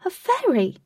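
high, loud exclamation; astonished shout. A narrator's reading. Katie spotting a tiny winged lady, shouting. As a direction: shout it out in amazement. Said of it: "Katie shouted".

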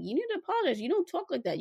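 put-on high, firm scolding of a child; stern mom scolding. Mom retells scolding her daughter for telling the teacher no, high firm scold. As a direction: lay down the law with your daughter. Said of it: "But I'm like".

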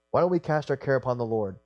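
ordinary delivery

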